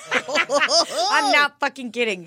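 slow, loud, laughing; laughing insistence. Real, not acted. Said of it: "not kidding".

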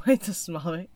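ordinary talk

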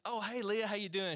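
eager hello. Retold or imitated. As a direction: imitate your overeager younger self greeting her. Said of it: "I just remember being like".

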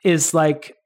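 ordinary talk

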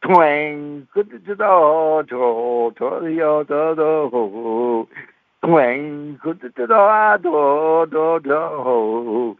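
sung-out twangs and plucks, voice jumping about; mock banjo twang. Preacher imitating the boy's one-string banjo serenade. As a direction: play the banjo with your mouth. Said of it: "he took that one string banjo and he went".

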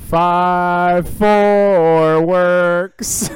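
"Five four works" stretched very slowly and high; drawn-out five four works. Shown as a demonstration. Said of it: "that's not how you say it".

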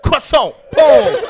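high, very loud teasing shout; mock French shout. Teasing friend re-enacts punching a French guy, mock shouting.

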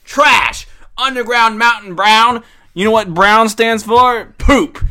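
loud, high, disgusted rant. Real, not acted. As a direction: trash the beer with loud disgust. Ranting disgust at beer.

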